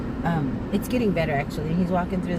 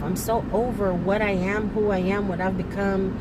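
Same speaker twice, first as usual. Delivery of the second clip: normal talking voice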